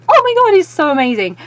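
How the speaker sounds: very high, loud gushing; high-pitched burst of delight; fangirl squeal. Retold or imitated. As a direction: squeal with giddy excitement. Acting out fangirl excitement.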